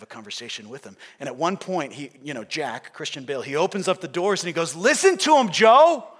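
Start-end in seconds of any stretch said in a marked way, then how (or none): 4.7-6.1 loud, high-pitched Brooklyn shout; Brooklyn accent. Acted. Newsboy confronting the newspaper king, shouted Brooklyn accent. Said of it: "in his Brooklyn accent".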